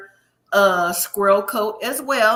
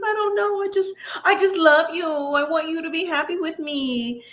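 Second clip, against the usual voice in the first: higher, whiny mock sob of a girlfriend; imitating crying Madeline; 7 semitones higher than usual. Recapping Madeline crying to Luke on the boat, in a mock tearful whine. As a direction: play her weepy plea, half-mocking. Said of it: "So Madeline starts crying".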